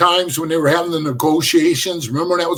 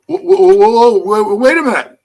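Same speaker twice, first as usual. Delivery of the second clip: louder, halting objection; alarmed protest; 5 dB louder than usual. Retold or imitated. Prospect objecting to avoiding his friend, protesting. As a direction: cut in to stop him, retold.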